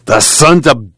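slow, loud, high-pitched outburst; bitter cursing. A narrator's reading. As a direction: curse them through the upset, drawing it out. Tom curses after smothered sobbing.

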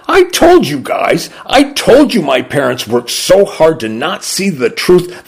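put-on exclaiming teenage son. A narrator's reading. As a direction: crow it to your friends, high at the start. Son exclaims to friends about parents.

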